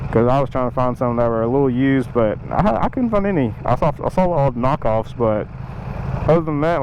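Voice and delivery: muffled helmet mic with wind noise; talking from inside a motorcycle helmet while riding. Real, not acted. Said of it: "move my mic further back into my helmet".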